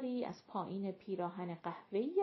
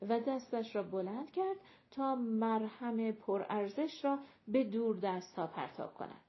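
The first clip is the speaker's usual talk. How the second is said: ordinary delivery, nothing marked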